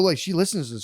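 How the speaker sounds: usual speaking voice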